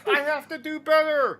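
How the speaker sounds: very high, strained wail; high-pitched mock pleading; mock resolve. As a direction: squeal the slogan at normal volume. Same slogan repeated in mockery.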